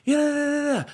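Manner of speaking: high, loud dismissive yeah yeah yeah; mom's high, loud brush-off to her son; mom brushing him off. Retold or imitated. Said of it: "She's like".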